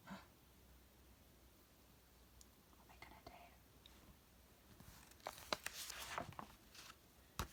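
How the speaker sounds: hushed, whispered suspense. Acted. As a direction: lean in and whisper. Suspenseful aside to listener.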